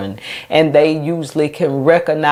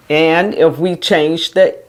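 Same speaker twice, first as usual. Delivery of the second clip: ordinary talk, nothing marked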